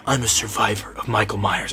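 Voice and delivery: low, put-on solemn voice; mock self-important survivor. Tommy boasting of his one brush with Michael, mock-solemn. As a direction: imitate a man milking his survival. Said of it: "he acts like".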